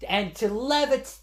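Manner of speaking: ordinary talk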